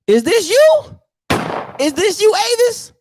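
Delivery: high, incredulous "is this you"; mock disbelief. Real, not acted. Roasting a fan photo, high mock disbelief. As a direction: feign shock at the photo.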